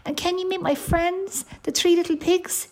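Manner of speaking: high-pitched eager bear cub; little bear. Acted. Baby bear invites his new friend, high little voice. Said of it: "he said".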